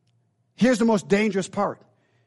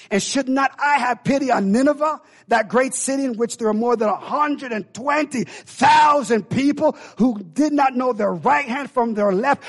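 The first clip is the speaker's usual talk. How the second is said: shouted, higher, slowing; booming rebuke; 4 semitones higher than usual. Acted. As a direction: thunder it out as God. God rebuking Jonah, shouted.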